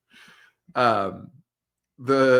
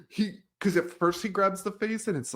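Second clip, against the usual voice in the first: normal talking voice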